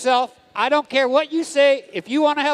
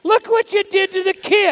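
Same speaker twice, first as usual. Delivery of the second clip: mock outrage; 7 semitones higher than usual. Clown scolds announcer over kid.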